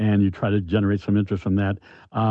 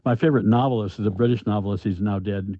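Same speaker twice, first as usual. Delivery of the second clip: usual speaking voice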